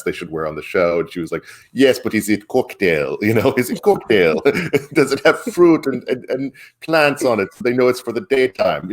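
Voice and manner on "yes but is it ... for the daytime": put-on overdone Colombian accent, pitched up and laughing; imitating exaggerated accent. Podcast host retelling a talk show, exaggerated Colombian accent. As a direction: ham up a Colombian accent like a TV actress. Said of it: "a whole imitation of it and, and over imitating her".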